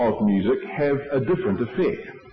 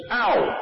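sudden high-pitched "Ow!"; yelp of pain; 10 semitones higher than usual. Acted. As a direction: cry out as if the hammer hit you. Acted hammer hitting finger.

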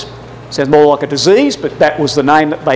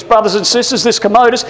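ordinary delivery

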